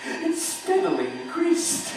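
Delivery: frantic narrator, voice climbing; a man losing his nerve; mounting panic. Acted.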